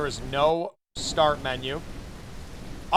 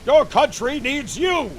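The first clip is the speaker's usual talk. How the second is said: high, slow, booming recruiter; recruiting poster voice; 10 semitones higher, 6 dB louder, slower than usual. Retold or imitated. Streamer mimicking the recruitment poster, high and slow. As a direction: proclaim it like an old war poster. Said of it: "exactly how that guy sounds".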